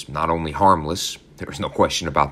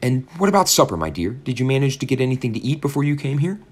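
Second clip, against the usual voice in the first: put-on high, gushing old landlady; landlady's voice; 9 semitones higher, 3 dB louder than usual. A narrator's reading. Landlady gushing to her new young lodger, high and sweet. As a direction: purr over every word, eager to please.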